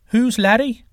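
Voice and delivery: high, loud puzzled question; baffled wind god. Acted. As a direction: ask cluelessly, pitched well up.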